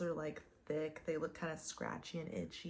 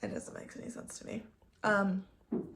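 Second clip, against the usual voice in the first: annoyed; 2 semitones higher, faster than usual. Real, not acted. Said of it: "actually pissing me off".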